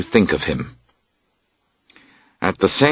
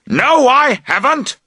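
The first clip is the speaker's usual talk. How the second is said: high-pitched denial shout; high indignant shout. Acted. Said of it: "he shouts".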